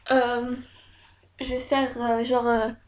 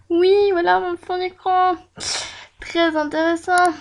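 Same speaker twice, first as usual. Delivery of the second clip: higher, louder showing-off voice; mock enthusiasm; 6 semitones higher, 5 dB louder than usual. Real, not acted. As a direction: present it loudly as if fascinating. Shows her desktop while waiting.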